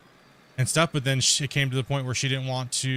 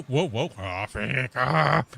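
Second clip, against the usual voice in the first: ordinary talk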